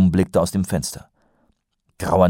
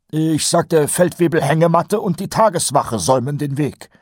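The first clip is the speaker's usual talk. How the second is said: higher, nasal-free eager sergeant voice; Kolon's voice; 10 semitones higher, 4 dB louder than usual. A narrator's reading. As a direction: play the chatty old sergeant, pitched up. Colon voiced by narrator. (höher gesprochener Feldwebel Kolon)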